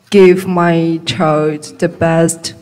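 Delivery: normal talking voice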